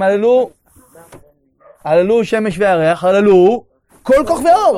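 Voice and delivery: loud, ringing psalm call; proclaiming praise. Acted. Proclaims psalm in guided visualisation.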